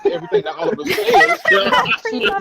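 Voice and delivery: breaks into high laughter on the last words; laughing at the sidekick who always nods along. Real, not acted. Laughing while mocking the Arrow sidekick to friends.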